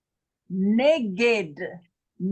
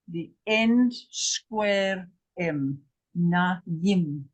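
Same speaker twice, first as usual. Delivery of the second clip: slow; much slower than usual. Real, not acted. Slow explanation.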